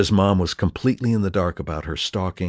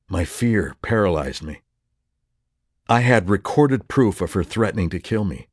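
ordinary delivery, nothing marked

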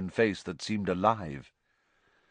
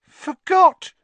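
very high, loud disbelieving echo; weakly shocked; 12 dB louder than usual. A narrator's reading. Conductor repeats passenger's excuse, weakly shocked. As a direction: squeak it back in shock. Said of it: "said Stan weakly".

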